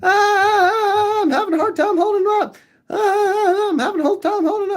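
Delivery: high, fast strained cry of a man dangling; strained bad guy. Retelling the bridge scene, villain's high fast strained cry. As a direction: squeal it out like the villain hanging from the rope. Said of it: "and I'm like".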